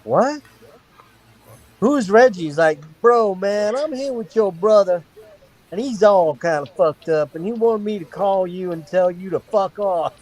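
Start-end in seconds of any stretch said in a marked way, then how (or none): 0.0-2.4 surprised. Retold or imitated. Confused reply to stranger. 3.0-10.1 stranger's voice. Retold or imitated. Stranger relays brother's message.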